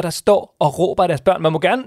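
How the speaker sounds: ordinary talk, nothing marked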